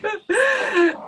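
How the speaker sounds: a high, loud laugh bursting out; burst of laughter. Real, not acted. Breaks into a laugh mid-story.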